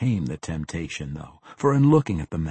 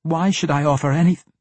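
high, loud defiance, broken off short; bitten-off retort; 8 semitones higher, 6 dB louder than usual. A narrator's reading. Captive defies the assassin until the dagger moves, bitten-off retort. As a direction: protest loudly, then bite off the last word. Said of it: "She bit off the last word".

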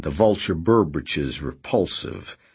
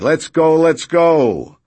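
boisterous; 11 semitones higher, 9 dB louder, slower than usual. A narrator's reading. Redrick rallying, loud.

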